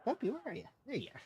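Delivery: high, soft sing-song for the dog; sweet high dog voice. Real, not acted.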